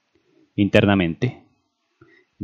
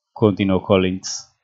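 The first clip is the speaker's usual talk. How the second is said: plain reading voice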